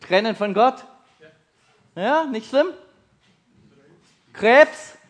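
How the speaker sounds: normal talking voice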